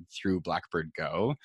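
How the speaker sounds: ordinary talk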